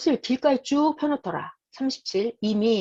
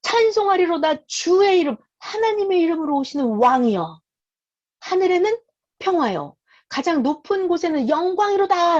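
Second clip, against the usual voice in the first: high, lifted voice of a praising crowd; acted joyful acclamation; loud praise; 6 semitones higher, 3 dB louder than usual. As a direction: proclaim the praise up high, holding the long words. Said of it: "큰 소리로 하나님을 찬양합니다".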